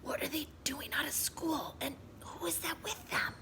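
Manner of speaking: high, soft scratchy whisper. Acted. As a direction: rasp it out in a thin whisper. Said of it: "she said in a scratchy voice".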